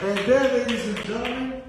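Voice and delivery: loud, high showman announcement; announcer. Real, not acted. Host presenting the audience, loud announcer style. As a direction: present it like a ringmaster.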